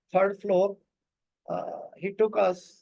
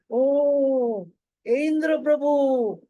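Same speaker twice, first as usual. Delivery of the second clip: delighted greeting; 5 semitones higher, 5 dB louder, much slower than usual. Retold or imitated. Mother greets a revered monk.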